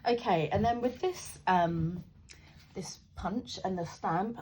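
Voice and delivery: ordinary talk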